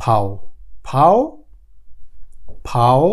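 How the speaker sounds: "ফাও" as German V, pronounced "fow"; German letter V. Shown as a demonstration. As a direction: drill "ফাও" slowly, f into an ow glide. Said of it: "ভি এর উচ্চারণটা হবে".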